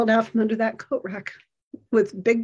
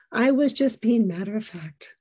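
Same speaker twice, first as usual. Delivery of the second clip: ordinary talk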